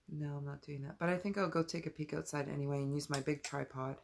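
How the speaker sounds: usual speaking voice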